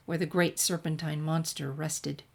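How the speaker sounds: ordinary delivery, nothing marked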